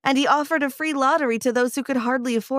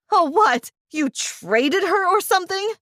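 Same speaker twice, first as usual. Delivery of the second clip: crying out; 6 semitones higher than usual. A narrator's reading. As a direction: throw the question back at them, disbelieving. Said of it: "I cried".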